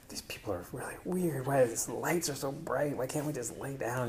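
whispered complaint to oneself; hushed inner grumble. Retold or imitated. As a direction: mutter it softly so nobody hears.

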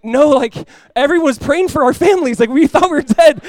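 high, loud, urgent pleading; retold exasperated insistence; insistent protest. Explaining to disbelieving wife after nearly dying, insistent protest.

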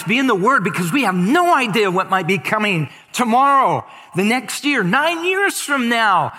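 rally the flock with urgency; fervent preaching. Acted. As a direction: passionate sermon climax. Preaching builds to exhortation.

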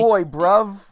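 loud, high London slang impression; chav accent. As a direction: bark it like a rough London lad. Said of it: "he talks like a, a chav".

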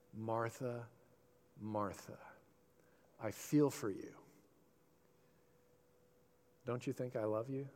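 very soft, low and affectionate; tender. Acted. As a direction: say it gently, feeling for her.

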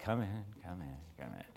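imitating a reluctant, sleepy host; weary retold muttering. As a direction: wave her in under your breath. Pained, woken man lets her in.

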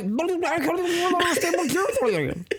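high garbled voice, like talking through water; underwater voice. Retold or imitated. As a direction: burble the words as if submerged. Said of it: "talented at speaking underwater voice".

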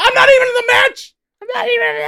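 very high, loud mock protest; hammed-up squealing alarm; frantic imitated yelp, far above normal voice; Paul Heyman panicking.

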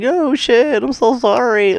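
high cartoonish sorry voice; mock apologetic hero. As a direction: whine the apology like a startled kid. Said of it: "fucking Hero's like".

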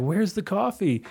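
high, slow baffled question; mock puzzlement. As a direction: ask it slowly, confused.

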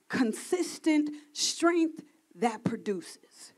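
breathy whisper, slow and hushed. Acted. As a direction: whisper it like God's secret. Reveals God's quiet words.

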